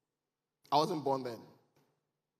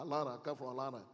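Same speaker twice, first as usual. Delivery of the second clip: Atlanta accent, swallowing the t's in "Atlanta"; Atlanta local accent; 2 semitones lower, 6 dB quieter, faster than usual. Shown as a demonstration. Immigrant imitating Atlanta locals when asked his origin, Atlanta accent. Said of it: "say it like people from Atla".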